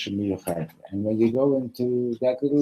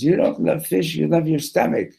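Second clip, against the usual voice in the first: raised voice, mock outrage building to the last words; indignant fish; 7 semitones higher, 6 dB louder than usual. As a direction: object indignantly as the doomed fish. Fish protests to the king.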